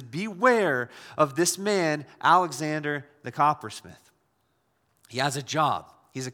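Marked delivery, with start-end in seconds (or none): 0.0-4.1 very slow, drawn-out beware; solemn warning. Retold or imitated. Paul warning the church about Alexander, solemn. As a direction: stretch out beware like a grave alarm. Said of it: "He says".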